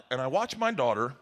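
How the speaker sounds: ordinary delivery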